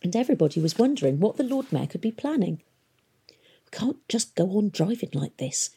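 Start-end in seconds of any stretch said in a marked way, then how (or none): 3.3-5.7 hushed, breathy servant worry; a nervous servant whispering her fear; muttered worry. A narrator's reading. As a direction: mutter it under your breath to a fellow servant. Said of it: "heard one of her servants mutter".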